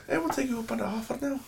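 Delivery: high-pitched imitation Irish accent; mock Irish accent. Mimicking Irish guys accepting the offer, in mock accent. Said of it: "stop doing the accent".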